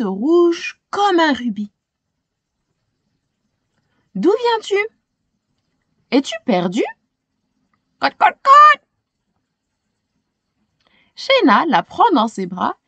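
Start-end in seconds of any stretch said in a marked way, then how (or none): 4.2-6.8 lightly voiced young girl, higher. Little girl asks the lost hen, lightly voiced. 8.0-8.8 high, loud clucking; hen clucking. Acted. The lost hen answers Shayna with loud clucks.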